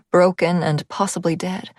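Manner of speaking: usual speaking voice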